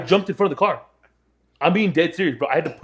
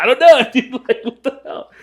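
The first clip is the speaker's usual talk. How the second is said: squeaky high laughing through the words; laughing quote; 13 semitones higher, 6 dB louder than usual. Retold or imitated. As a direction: crack up while protesting you're sober. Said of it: "And I was like".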